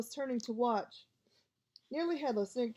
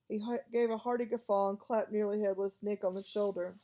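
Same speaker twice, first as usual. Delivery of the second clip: ordinary delivery, nothing marked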